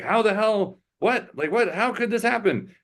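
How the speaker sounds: high, incredulous quote of onlookers; lightly acted baffled outrage; mock disbelief. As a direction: pitch it up in mock shock at the news. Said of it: "people are like".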